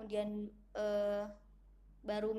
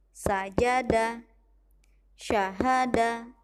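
each short syllable punched out much louder, slowly; loud clipped demo; 14 dB louder, much slower than usual. Shown as a demonstration. Teacher modeling short clipped syllables for pupils. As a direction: hammer out every word short and strong. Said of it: "Bunda contohkan satu kali lagi".

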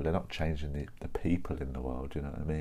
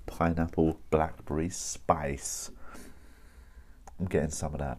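ordinary delivery

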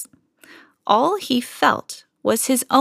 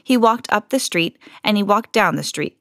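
plain reading voice

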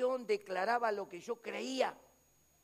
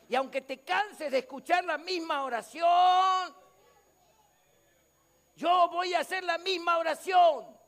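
higher, louder preaching cry; declaring to the church he will keep praying; preaching shout; 8 semitones higher, 9 dB louder than usual. Real, not acted. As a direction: shout it at the congregation. Pastor insists on repeated prayer.

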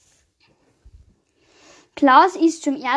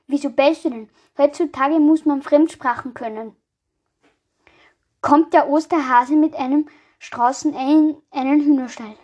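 ordinary talk, nothing marked

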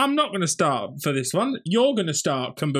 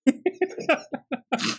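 real laugh; 9 semitones higher than usual. Real, not acted.

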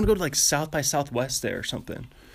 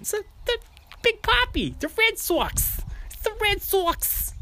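very high, drawn-out mock Boston accent. Playing a devoted Red Sox fan, high Boston accent. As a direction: stretch the vowels in a squealed Boston caricature. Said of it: "think of the Red Sox".

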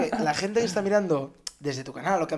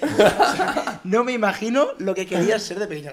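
laughing; 4 semitones higher, 7 dB louder than usual. Real, not acted. Host laughing while asking.

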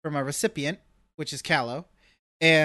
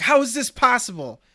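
mock panic; 8 semitones higher, 5 dB louder than usual. Panic over dripping box.

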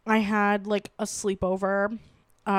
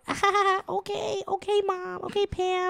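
put-on higher, whiny teen brush-off; whiny okay; 9 semitones higher than usual. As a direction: whine it like a kid being sent to bed. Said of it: "we're like".

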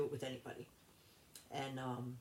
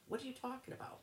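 high-pitched mock disbelief; incredulous retort; 11 semitones higher, faster than usual. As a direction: imitate his indignant denial, pitched way up. Retold confrontation, partner's indignant denial.

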